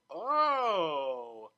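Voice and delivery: a loud, startled Oh; sudden exclamation. Acted. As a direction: blurt it out as it dawns on you. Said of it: "exclaimed the dark brown stallion".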